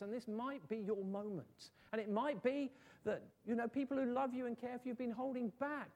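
high-pitched, urgent gospel invitation; earnest preaching appeal. Real, not acted. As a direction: plead with the congregation, voice lifted.

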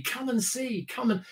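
a touch of keen urging; mildly excited. Acted. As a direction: beckon someone over with light, acted eagerness. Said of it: "if I get excited about something".

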